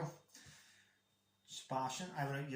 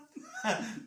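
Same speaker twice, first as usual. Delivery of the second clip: high burst of laughter; 5 dB louder than usual. Real, not acted. Laughs after singing.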